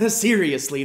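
a quick laugh into the word; light laugh leading in. Real, not acted. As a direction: chuckle it out before getting serious. Laughs before urging seriousness.

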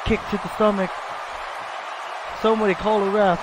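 hammed-up panic calling for a ref; frantic commentator. Commentator screaming for a referee during the brawl.

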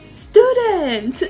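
high, loud, proud "Student!"; cute imitation. Imitating Junkai spelling a word, high and proud. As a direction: proudly announce "Student!" like a kid. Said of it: "when he was like".

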